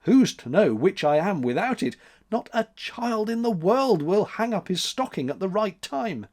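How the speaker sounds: vociferating. A narrator's reading. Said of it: "vociferated December".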